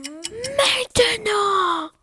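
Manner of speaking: étiré, rauque, menaçant; le loup bondit; grondement de loup. Acted. Le loup commence à attaquer. (le loup lance la chasse en grondant)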